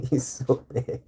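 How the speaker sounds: "He's so big" through a laugh, slowish. Real, not acted. Laughing at how huge his cat is. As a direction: giggle the words out.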